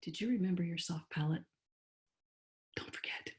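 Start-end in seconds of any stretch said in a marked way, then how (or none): 2.8-3.3 whispered reminder. Real, not acted. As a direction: breathe it out without voice. Aside to the class.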